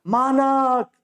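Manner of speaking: rising cries of a name; mother calling her lost son. Acted. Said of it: "उसी तरह चिल्लाने लगती है".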